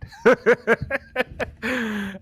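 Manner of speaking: loud, high burst of real laughter; big laugh. Real, not acted. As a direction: crack up hard at the memory.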